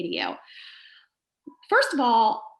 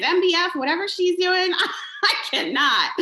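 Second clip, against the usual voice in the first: laughing disbelief; 6 semitones higher, 3 dB louder than usual. Real, not acted.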